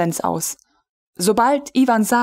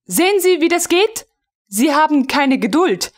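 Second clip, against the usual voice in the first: higher, brisk know-it-all instruction; lecturing lady; 8 semitones higher than usual. A narrator's reading. Frau von Melin lecturing the impatient officer, briskly superior. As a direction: show him up while teaching.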